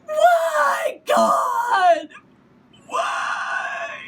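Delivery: very high, loud anguished cry of why; screaming at God. Retold or imitated. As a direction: wail it up to the sky in despair. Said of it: "went out onto the deck and screamed".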